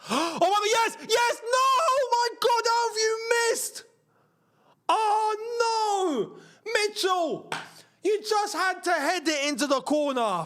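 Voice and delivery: high shouts from joy to despair; anguished fan shouting. Real, not acted. Fan watching a sitter missed, shouting yes then anguished. Team misses big chance.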